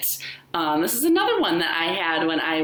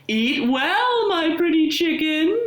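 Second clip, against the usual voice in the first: higher, louder sing-song wolf; sly wolf calling out to the chicken; wolf crying out; 11 semitones higher, 3 dB louder than usual. A narrator's reading. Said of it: "he cried".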